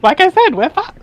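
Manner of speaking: high squeaky "we're fucked"; mock despair. Real, not acted. Friend reacting to doomed planet, high mock despair. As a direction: squeak the doom. Repeats joke.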